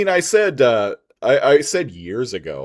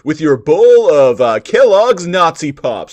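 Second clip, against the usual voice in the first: cereal mascot; 7 semitones higher, 10 dB louder than usual. Pretend. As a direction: sell it like a TV cereal spot. Mock cereal commercial.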